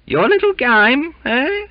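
very high, loud mocking squeak; hammed-up jovial Cockney. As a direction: tease him with a knowing wink. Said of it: "commented Duncan jovially".

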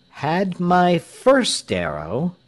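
high, drawn-out, weighty declaration; slow and deliberate. A narrator's reading. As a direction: say it slowly, pitch held high. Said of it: "said Tell slowly".

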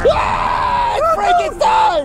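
high-pitched shriek of alarm; terrified scream. Acted. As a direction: scream in terror at the monster. Startled at seeing the monster.

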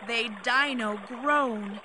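plain reading voice

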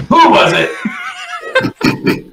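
high, slow, laughing demand. Acted. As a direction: break into laughter mid-question.

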